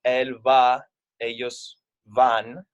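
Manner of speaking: each verb form spoken slowly and clearly; slow Spanish demo. Shown as a demonstration. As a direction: spell it out slowly for the class, like a teacher. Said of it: "whereas in Spanish".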